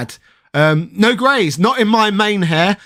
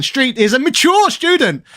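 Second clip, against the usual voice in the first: mature pitched up high for emphasis; stress on MATURE; 8 semitones higher than usual. Real, not acted. Congratulating a friend on his essay, heavy stress on mature. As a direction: lean hard on the word mature, higher. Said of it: "shouldn't put too much emphasis on the word mature".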